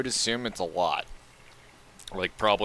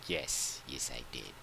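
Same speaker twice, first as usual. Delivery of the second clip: much softer, smug little confirmation; smug reply; 10 semitones lower, 19 dB quieter than usual. Retold or imitated. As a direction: murmur it, quietly pleased with yourself.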